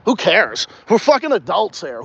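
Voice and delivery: normal talking voice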